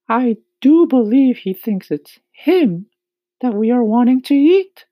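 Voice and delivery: high, loud ladybug exclamation; ladybug crying out. A narrator's reading. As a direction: cry out in high-pitched concern.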